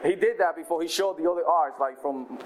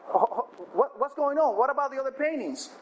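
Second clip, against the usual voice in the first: shocked protest; 7 semitones higher than usual. Acted. Crowd outraged at auction end.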